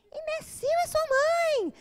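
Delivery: high, loud, slow shriek; girl turns round and yells at the boy who insulted her; shrieked comeback. Retold or imitated. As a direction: scream it back at him. Girl screams back at boy.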